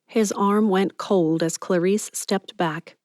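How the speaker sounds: plain reading voice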